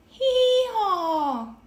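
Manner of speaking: high, loud braying hee-haw; loud high donkey call. A narrator's reading. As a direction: bray like a donkey. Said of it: "The donkey said".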